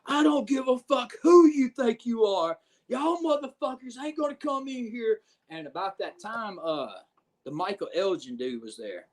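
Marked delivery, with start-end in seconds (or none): 0.0-5.2 higher, louder furious yell at the room; retold screaming. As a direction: storm through the curtain yelling it. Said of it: "he's fucking screaming at the top of his lungs".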